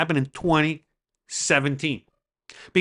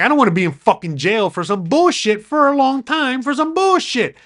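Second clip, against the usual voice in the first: exasperated outburst; 8 semitones higher, 4 dB louder than usual. Real, not acted. Venting about jail over nothing.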